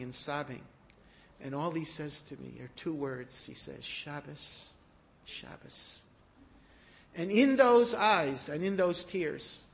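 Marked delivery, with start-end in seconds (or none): 3.8-4.7 soft, choked "Shabbos"; quiet tearful word. Acted. Sobbing Chafetz Chaim says two words. 5.2-6.0 whispered. Acted. Sobbing Chafetz Chaim says two words.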